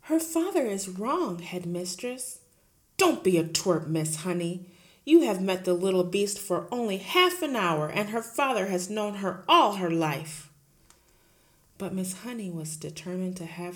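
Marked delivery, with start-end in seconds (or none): 0.0-2.4 lightly voiced gentle teacher; a hint of the meek young teacher; Miss Honey pleading. Teacher protesting to her headmistress, lightly voiced. 3.0-10.5 loud, booming headmistress; bully the teacher at full volume; Trunchbull bullying. Acted. Headmistress roaring at Miss Honey, loud and bullying.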